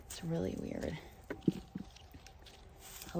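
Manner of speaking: soft breathy aside; whispering. Real, not acted. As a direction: drop to a hush. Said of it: "I need to whisper".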